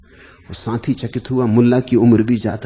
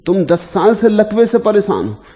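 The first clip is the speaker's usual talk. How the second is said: high, loud disbelief; astonished crowd; 8 semitones higher, 7 dB louder than usual. Retold or imitated. Onlookers seeing a paralysed man walk out, astonished. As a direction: cry out as stunned onlookers.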